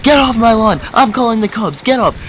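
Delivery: high, strained mock scream; hammed-up high-pitched outrage; imitated angry screaming. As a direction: play a furious homeowner yelling from the door. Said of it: "the guy starts screaming his head off".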